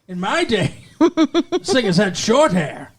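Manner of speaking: put-on old-timer voice. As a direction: rant like a grumpy old-timer.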